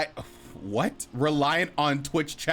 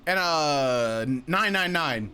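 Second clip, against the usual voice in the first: ordinary talk, nothing marked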